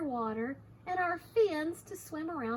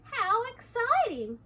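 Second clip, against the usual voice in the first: high, loud burst of delight; excited exclamation; 11 semitones higher, 7 dB louder, slower than usual. Acted. Hector delights in being chickens, excited. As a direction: exclaim with lifted pitch.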